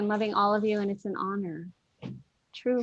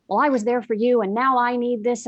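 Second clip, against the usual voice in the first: demanding; 3 semitones higher, 8 dB louder than usual. Retold or imitated. Retold demand from former friend.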